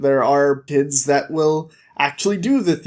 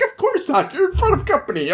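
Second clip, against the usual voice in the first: very high, strained retort; high-pitched protest; 13 semitones higher, faster than usual. Retold or imitated. Thiefmaker corrects himself before the eyeless priest, high squeaky protest. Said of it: "And he's like".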